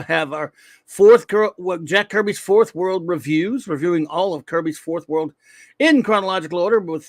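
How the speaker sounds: ordinary talk, nothing marked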